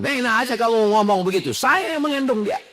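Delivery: high, indignant wife's voice talking back; wife arguing back. Acted. Wife snaps back at her husband, high and indignant. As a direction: protest like an offended mother. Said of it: "Istrinya balik ngelawan".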